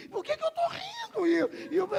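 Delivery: very high, loud, overwrought cry; bewildered shout. Retold or imitated. Preacher acts out his mind protesting the laughter, bewildered high shout. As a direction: cry out helplessly, stumbling into the words.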